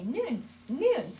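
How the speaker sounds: high, loud "Noon, noon" with n for m; "Noon" as the child says moon; child saying noon. Retold or imitated. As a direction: copy the toddler's "Noon". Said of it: "he'll say".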